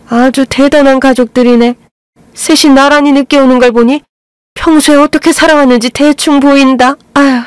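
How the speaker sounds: mother-in-law sneering at the late family; sarcastic sneer. A narrator's reading. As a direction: look down on them as you speak.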